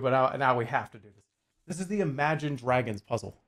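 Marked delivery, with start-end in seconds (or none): none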